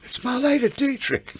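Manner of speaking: laughing out the name, high and slow; can barely get it out for laughing. Retold or imitated. Telling Margot who is at the door, laughing. Star-struck at the dressing-room door.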